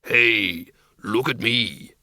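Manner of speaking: low, slow creature voice; deep, unhurried monster hello; bogeyman. A narrator's reading. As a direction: greet them slowly as a big hairy bogeyman. Bogeyman Schleppel shows off.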